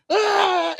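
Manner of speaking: high, held mock yell; imitated wrestler yell. Retelling wrestlers before they hug, high mock yell. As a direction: hold one last high yell.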